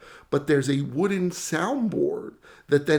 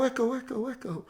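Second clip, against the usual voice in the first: the same call repeated, softer and dying away; fading echo; 8 semitones higher, 5 dB quieter than usual. Pretend. The band shell throwing back the echo, dying away. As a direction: let the echo fade to nothing. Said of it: "they hear it echo".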